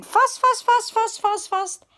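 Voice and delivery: very high, loud urgent chant. A narrator's reading. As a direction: race the words breathlessly. Pigs running away.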